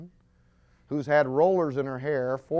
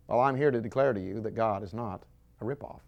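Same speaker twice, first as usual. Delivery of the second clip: ordinary talk, nothing marked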